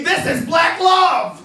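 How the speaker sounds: slow, high pretend scream; mock ecstatic shout. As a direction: belt it out to the front row.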